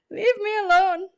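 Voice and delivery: high, hammed-up crying plea; mock sobbing plea. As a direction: beg it to stop, half-crying, playing it up. Pleading with the whisky's burn.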